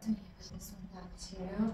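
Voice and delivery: a real unvoiced whisper, secretive. Real, not acted. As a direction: drop to a hushed whisper, as if nobody else should hear. Whispered aside to camera.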